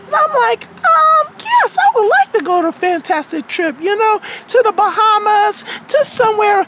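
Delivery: character storytelling voice; aggrieved Wendy. Acted. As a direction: recount it as a wronged cartoon girl. Wendy tells of the trip offer.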